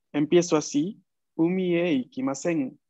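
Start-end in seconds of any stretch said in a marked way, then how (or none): 1.4-2.8 "Umie ikimasen" slow, pitch stepping down; falling tune. Shown as a demonstration. Teacher shows students "Umie ikimasen" descending. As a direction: glide "Umie ikimasen" downward. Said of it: "y desciendo en el tono".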